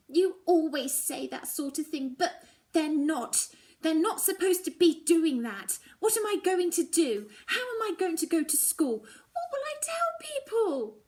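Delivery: loud, high tearful protest; a teenager's loud upset cry; upset outburst. A narrator's reading. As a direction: rail at her mother in distress. Upset daughter shouting at mother.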